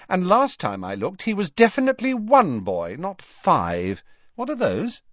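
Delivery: high-pitched, pointed retort; sister Ginny, defiant. A narrator's reading. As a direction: correct the brothers, then change the subject.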